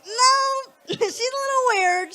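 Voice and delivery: high, loud, slow mocking dude; mock guy voice. Guy telling his friend about her, high and hammed-up. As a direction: ham up a guy disowning her. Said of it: "It's like".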